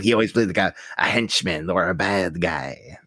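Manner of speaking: low, creepy henchman imitation; fifties movie villain. Podcaster describing a typecast actor in his voice, mock villain. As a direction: keep up the old-film baddie voice. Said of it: "always talking like this".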